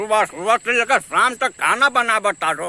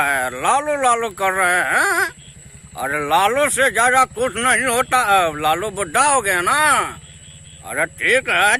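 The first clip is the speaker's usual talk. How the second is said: Lalu Yadav. Acted. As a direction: hold forth like a folksy Bihari leader. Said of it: "Lalu Yadav का हो".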